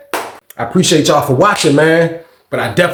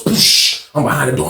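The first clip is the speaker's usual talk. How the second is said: usual speaking voice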